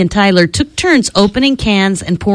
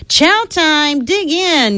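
mock yell in a raised pitch; storybook yell; 8 semitones higher, slower than usual. A narrator's reading. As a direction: call everyone to the table, pitched up. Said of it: "yelled Hector".

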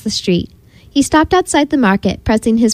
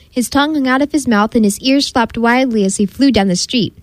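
ordinary delivery, nothing marked

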